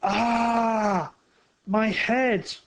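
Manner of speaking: a sharp, loud cry of pain; loud pained shout. A narrator's reading. Thief hit by a bottle, crying out in pain. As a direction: yell out as the bottle hits your head. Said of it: "The thief shouted very loudly".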